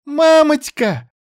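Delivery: put-on high, slow child calling mom; little girl shouts for mommy; ringing child's call. A narrator's reading. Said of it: "раздался звонкий голос Вали".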